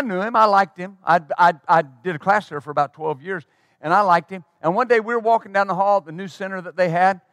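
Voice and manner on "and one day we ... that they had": ordinary talk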